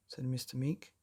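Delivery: ordinary delivery, nothing marked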